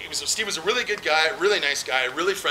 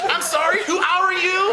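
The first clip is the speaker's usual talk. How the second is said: imagined caller; 13 semitones higher, 5 dB louder, slower than usual. Pretend.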